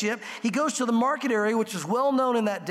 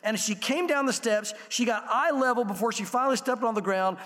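ordinary talk

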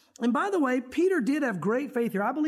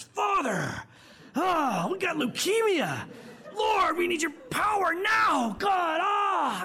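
hammed-up shouted prayer; 6 semitones higher, much slower than usual. Shown as a demonstration. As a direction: pray as if volume makes the miracle. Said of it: "It's not our inflection. It's not how we grunt".

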